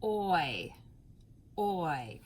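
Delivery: "Oi" said slowly with rounded lips opening; teacher demo of "Oi"; phonics sound oi. Shown as a demonstration. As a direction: model the sound "Oi" for the class. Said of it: "say that sound with me and watch my mouth".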